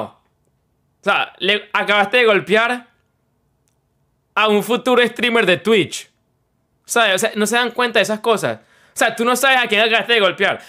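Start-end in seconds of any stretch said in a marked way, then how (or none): none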